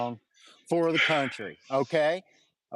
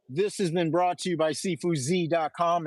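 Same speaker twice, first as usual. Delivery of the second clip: normal talking voice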